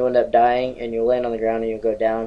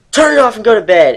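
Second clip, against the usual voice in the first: mock angry mom; 8 semitones higher, 9 dB louder than usual. Mom orders him to bed.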